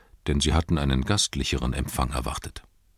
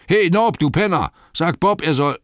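much higher, loud yell; yelling for servant; 11 semitones higher, 6 dB louder than usual. Acted. Innkeeper shouting at servant Nob, loud yell. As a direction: bellow across the inn.

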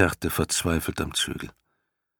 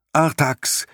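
much higher and louder desperate cry of a name; screaming; 10 semitones higher, 5 dB louder than usual. A narrator's reading. Boy Atreo screams to his sinking horse, desperate and loud. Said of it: "schrie Atreo".

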